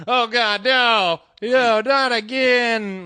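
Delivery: high, loud, drawn-out whine of dread; hangover dread. Pretend. Waking up hungover, moaning high and loud in dread. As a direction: moan it like a man facing another hangover. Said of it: "I'm immediately gonna be like".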